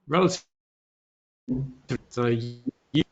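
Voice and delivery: choppy, breaking-up audio; bad connection. Real, not acted. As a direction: voice cutting in and out. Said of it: "your voice is breaking".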